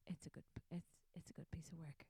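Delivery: soft whispered aside; whispering. Real, not acted. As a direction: whisper it to yourself.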